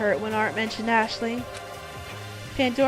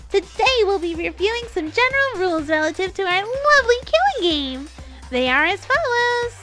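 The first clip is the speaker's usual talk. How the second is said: high, loud, hyped announcer voice; fighting game announcer; 10 semitones higher, 6 dB louder than usual. Acted. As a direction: boom it out like a show host. Announcer voice in story.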